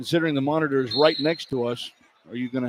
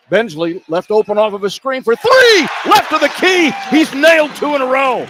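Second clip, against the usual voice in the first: loud, much higher burst of excitement; excited shout; 11 semitones higher, 7 dB louder than usual. Real, not acted. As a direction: belt out the made three. Three-pointer hits, announcer erupts.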